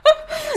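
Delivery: sudden high-pitched laugh; high burst of laughter. Real, not acted. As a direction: laugh out loud in a high squeal.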